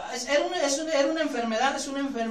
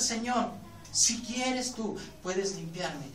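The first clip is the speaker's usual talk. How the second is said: soft, slow humble plea; quiet, lowered pleading prayer; 6 semitones lower, 8 dB quieter, slower than usual. Retold or imitated. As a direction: beg softly, head bowed. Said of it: "si vienes con una actitud humilde".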